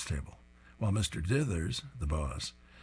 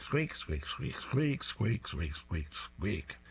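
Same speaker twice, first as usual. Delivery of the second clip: toy squeaks; 4 dB quieter, slower than usual. Retold or imitated.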